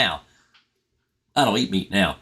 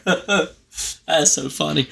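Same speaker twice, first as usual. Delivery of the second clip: laughing; 4 semitones higher, much slower than usual. Real, not acted. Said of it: "I'm laughing, I'm crying over here".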